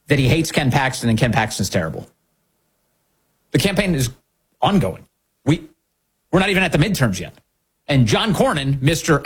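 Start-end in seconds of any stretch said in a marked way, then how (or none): none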